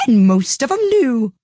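put-on high, slow, gruff old duchess; rough, haughty duchess voice. A narrator's reading. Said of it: "said the Duchess".

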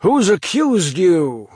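high, slow, loud challenge; shouted. Acted. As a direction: throw the question at him. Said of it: "shouted a voice".

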